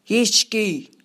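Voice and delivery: mild shout of a crowd's answer; understated group shout; shouted in chorus. A narrator's reading. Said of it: "ما فریاد زدیم".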